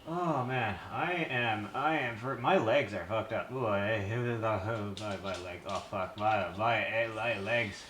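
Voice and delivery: winded, catching breath; panting between words. Real, not acted. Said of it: "I'm just catching my breath a little bit".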